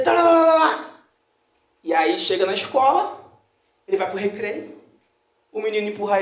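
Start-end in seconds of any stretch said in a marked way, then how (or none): 0.0-1.0 mock road-rage cursing. Parent cursing other drivers.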